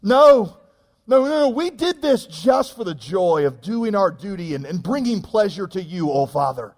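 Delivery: put-on high, slow, syrupy little girl's voice; pious little daughter. Daughter thanking Daddy for ice cream, mock saintly and high. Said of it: "one of my little girls looks back up at me and says".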